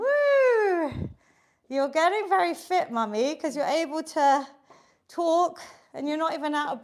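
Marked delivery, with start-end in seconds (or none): 0.0-1.1 high, loud whoop; cheerful whoop. Real, not acted. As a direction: whoop to celebrate the finish. Cheering end of countdown.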